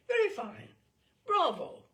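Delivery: high, loud praise; enthusiastic headmaster. Acted. Headmaster praising the boy's playing, high and loud.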